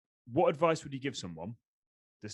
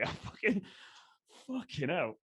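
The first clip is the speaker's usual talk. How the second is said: breaking into a real laugh, higher; laughing in disbelief, words spilling out; 6 semitones higher, slower than usual. Real, not acted. Host laughing at how good the guest's answer is.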